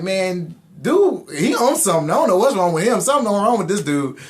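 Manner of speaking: high, loud disbelieving rant; exasperated. Retold or imitated. Venting to the cops about the bad cop, loud and high. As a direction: throw up your hands at this crazy cop.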